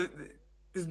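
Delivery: ordinary talk, nothing marked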